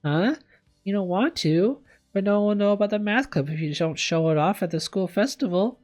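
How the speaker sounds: put-on high-pitched, questioning girl; incredulous and high; dismayed protest. A narrator's reading. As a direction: protest in a high, pleading rush. Kitty protests skipping the festival.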